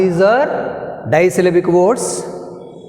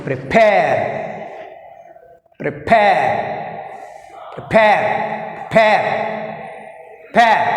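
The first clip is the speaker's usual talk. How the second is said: "prepare" with a forceful PARE; pre-PARE, "prepare" drilled one take at a time; stress on second syllable; 2 semitones higher, much slower than usual. Shown as a demonstration. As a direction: punch the end of each "prepare". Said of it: "Second syllable is pair".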